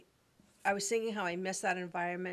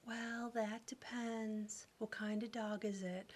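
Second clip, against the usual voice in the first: soft, very slow, half-asleep; sleepy poodle voice; 8 dB quieter, slower than usual. Retold or imitated. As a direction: answer the phone as if she has just woken up. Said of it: "sleepy, I just woke up, soft voice".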